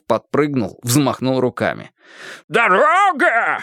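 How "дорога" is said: high, slow furious squeal; shriek. Acted. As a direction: squeal it in outrage. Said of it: "взвизгнул он".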